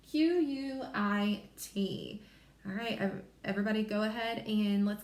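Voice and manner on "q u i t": slow letter-by-letter spelling; spelling slowly. Shown as a demonstration. Teacher spelling for young learners, slow letters. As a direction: spell it out one letter at a time. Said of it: "So we spell quit".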